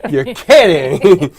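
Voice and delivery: loud, slow feigned astonishment; mock amazement. Friends hearing of the A D D, mock amazement. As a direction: draw it out loudly as if amazed. Retold sarcastic reaction to ADD news.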